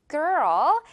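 put-on girl with a curled R then L; English girl. Shown as a demonstration. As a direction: roll the R in girl, close on L. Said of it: "그냥 걸 아니죠". (girl을 R 굴리고 L로 끝내기)

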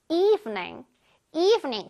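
"evening" with EVE punched and drawn out; stress on first syllable. Shown as a demonstration. Teacher models evening for pupils, first syllable stressed. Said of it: "이 부분을 조금 강세를 둬서".

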